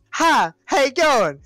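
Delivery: lightly voiced woman, high and drawn out; slow, high-pitched imitation of a cheery greeting; mock woman's voice. Retelling a meme where a woman comes in, mock high woman's voice. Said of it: "the woman comes in and goes".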